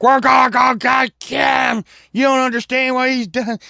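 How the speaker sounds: high, loud mock shouting; hammed-up angry dad yell; furious dads. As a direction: holler like outraged fathers forming a posse. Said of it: "our dads were like".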